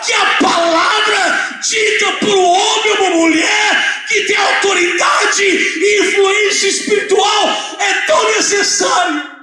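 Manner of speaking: high, drawn-out pulpit cry; preaching at full force. Real, not acted. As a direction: proclaim it high and forcefully to the congregation.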